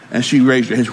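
ordinary talk, nothing marked

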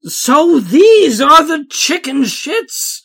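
booming president voice; booming big man. A narrator's reading. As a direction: boom it like a giant president. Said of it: "he boomed".

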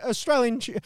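ordinary talk, nothing marked

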